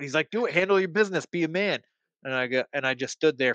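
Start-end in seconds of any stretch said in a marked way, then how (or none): 0.3-1.8 high-pitched dare; mimicked goading driver. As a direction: needle him in a raised, mocking imitation. Other driver dares him, acted.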